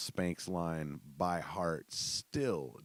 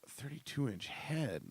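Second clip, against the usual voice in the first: incredulous; 8 semitones higher, 4 dB quieter, slower than usual. Retold or imitated. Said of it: "And I was like".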